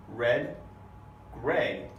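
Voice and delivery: RED and GRAY with a clearly sounded R; isolated demo words "Red, gray" with clean R; clean initial R. Shown as a demonstration. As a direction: say "Red, gray" one by one, R crisp. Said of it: "you hear a nice clean R".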